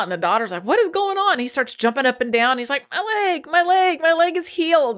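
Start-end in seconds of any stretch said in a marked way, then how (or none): 0.6-1.3 high-pitched alarmed question; freaking out. Retold or imitated. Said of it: "she's freaking out". 2.9-5.0 high-pitched imitated amazement; so excited. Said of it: "he's so excited".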